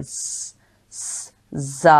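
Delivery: ज़ drawn out as a hissing buzz; whistling z. Shown as a demonstration. Teacher demonstrating the whistling ज़ sound. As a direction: hiss the ज़ through closed teeth. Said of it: "सीटी की सी आवाज़ निकलती है".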